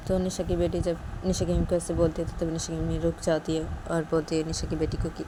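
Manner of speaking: ordinary delivery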